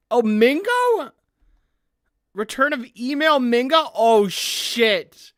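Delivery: high, loud gasp of disbelief; shocked surprise. Real, not acted. Streamer hears the old troll Mingo is back, loud shock. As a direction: blurt it out, floored by the news.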